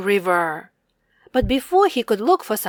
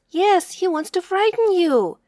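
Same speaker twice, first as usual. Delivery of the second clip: higher small bear voice; tiny bear voice; 7 semitones higher than usual. Acted. Tiny bear explaining to kittens, higher voice. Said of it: "added the tiny bear".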